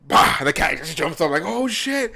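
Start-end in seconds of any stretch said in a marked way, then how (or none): 0.1-0.4 loud sudden bah to startle; loud stomp bang. Retold or imitated. Scaring the sleeping cat with a loud bah. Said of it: "slam my foot on the floor". 1.5-2.1 startled cat voice. Retold or imitated.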